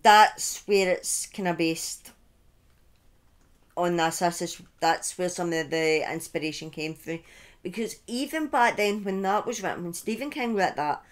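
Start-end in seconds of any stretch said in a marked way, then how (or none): none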